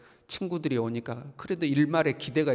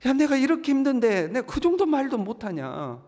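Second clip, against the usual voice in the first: anguished protest; 10 semitones higher than usual. Acted. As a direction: plead in pained exasperation. Job's anguished protest voiced.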